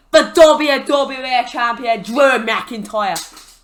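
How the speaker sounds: loud, rolling mock Scottish announcement; put-on Scottish accent. Storyteller announces the champion, bad Scottish accent. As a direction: proclaim it in a hammy Scottish accent. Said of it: "sorry for the accent".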